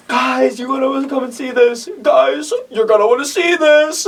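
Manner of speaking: high-pitched put-on calling voice; squeaky hammed-up summons; movie character impression. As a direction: mimic someone calling friends upstairs, way up high. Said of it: "how accurate was that voice?".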